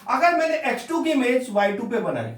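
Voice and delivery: usual speaking voice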